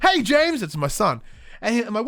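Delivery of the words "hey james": high, loud cheerful greeting; dad answering his son James, high and excited; playful reply. Retold or imitated. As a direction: answer back full of bounce.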